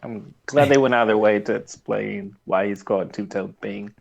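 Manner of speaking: normal talking voice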